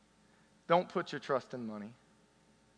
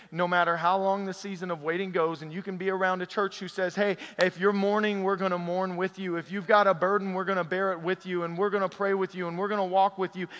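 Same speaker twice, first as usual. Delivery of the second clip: loud, high preaching voice; rousing sermon delivery; 4 semitones higher, 5 dB louder than usual. Acted. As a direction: preach it out to the room with force. Preaching at full force.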